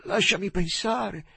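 put-on high, slow, drawn-out drunken voice; high strained plea for time; labored plea. A narrator's reading. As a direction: struggle to get the words out. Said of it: "mormorò Joe con fatica".

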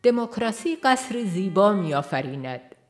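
usual speaking voice

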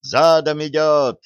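slow, high, loud holler; wild yell. A narrator's reading. Lookout warns the tankers from the forest edge, yelling. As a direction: stretch the shouted warning out. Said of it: "раздался дикий вопль с кромки леса".

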